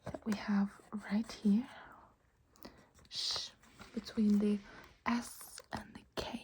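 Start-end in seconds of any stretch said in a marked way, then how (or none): 3.1-3.5 isolated "Sh", a hushing consonant; sh sound. Shown as a demonstration. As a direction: sound out "Sh" on its own. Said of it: "this is an sh sound".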